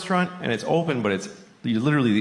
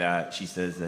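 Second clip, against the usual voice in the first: normal talking voice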